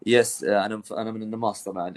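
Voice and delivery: normal talking voice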